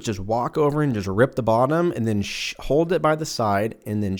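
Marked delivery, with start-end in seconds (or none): none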